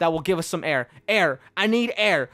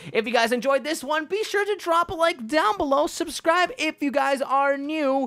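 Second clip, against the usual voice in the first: higher, upbeat channel sign-off; outro sign-off; 8 semitones higher than usual. Acted. Host signing off to viewers, in an upbeat, higher voice. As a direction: sell the subscribe like a host.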